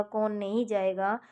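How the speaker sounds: ordinary delivery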